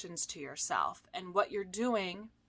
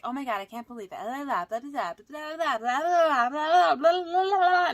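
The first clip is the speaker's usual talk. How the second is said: builds higher and louder into frantic blah blah; spinning out; 6 semitones higher, 7 dB louder than usual. Retold or imitated. Coach acting out anxious thoughts spiralling, climbing into a frantic rant. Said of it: "you start spinning more and more".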